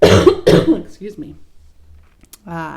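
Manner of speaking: ordinary delivery, nothing marked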